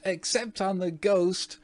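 very high shrieking repeat; shrieked. A narrator's reading. Twins capering, shrieking the same words. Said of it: "shrieked the twins".

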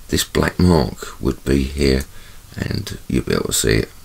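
ordinary delivery

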